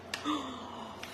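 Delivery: soft delighted in-breath gasp; surprised gasp. Real, not acted. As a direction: catch your breath as it fits. The piece fits.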